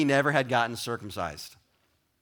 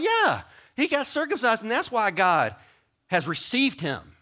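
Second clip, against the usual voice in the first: much higher, eager know-it-all; mock confident claim; 6 semitones higher than usual. Preacher voices people misreading circumcision, eager. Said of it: "They could be like".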